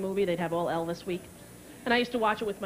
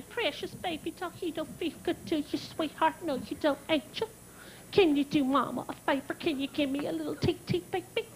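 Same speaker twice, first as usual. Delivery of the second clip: put-on babying nurse; 3 semitones higher, 5 dB quieter than usual. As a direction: coo at the patient like a small child.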